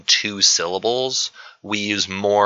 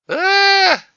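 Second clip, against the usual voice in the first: sudden loud, very high held "ah"; high mock cry; 6 dB louder than usual. Teacher playing around mid-quiz with students, high loud held cry. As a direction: blurt one high, loud, sustained note.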